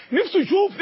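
rising longing. Acted. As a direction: push نفسه up with longing. Repeated, higher and louder. (شوق متصاعد على نفسه)

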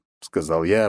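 plain reading voice

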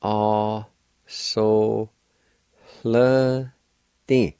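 "A, so, hle, di" syllable by syllable, breath before L in "hle"; separate, drawn-out "A, so, hle, di" with faint H. Shown as a demonstration. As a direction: stretch each syllable, aspirating "hle" in "A, so, hle, di". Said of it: "there's a faint H sound like this".

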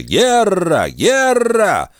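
high distant war cry; shouting from the street. A narrator's reading. Someone in the street shouts the alarm, high yell. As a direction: yell it out from afar. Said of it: "Крик не прекращался".

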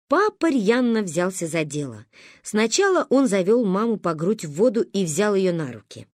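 ordinary delivery, nothing marked

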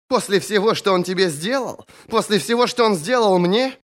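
high, outraged female character voice; incredulous, high-pitched accusation. A narrator's reading. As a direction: protest with pitch pushed way up. Thorn protests indignantly.

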